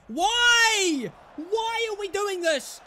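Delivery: high, stretched wail of frustration; loud opening cry, then drawn-out complaint; exasperated cry. Real, not acted. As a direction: plead with the team in dismay. Exasperated at own team.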